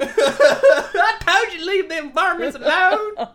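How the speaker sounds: high, loud, drawn-out laughing holler; comic backwoods telling-off; hammed-up scolding mother-in-law. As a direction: scold slowly in mock outrage while laughing. Replays the scolding role.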